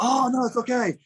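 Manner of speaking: high, reassuring mock voice; a light impression of friendly hosts; imitating insistent locals. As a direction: pitch way up, brushing off his protest.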